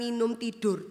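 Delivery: normal talking voice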